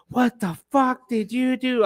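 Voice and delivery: high, slow, furious boss yelling at the new guy; angry manager. Retold or imitated. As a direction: play the manager blowing up over the ruined car. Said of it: "the manager comes out".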